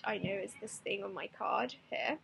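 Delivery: usual speaking voice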